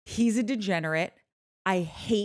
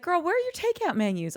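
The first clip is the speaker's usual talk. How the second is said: imitating a Kentucky friend, voice higher; a light Southern friend's voice, raised high; 8 semitones higher, faster than usual. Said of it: "And she's like".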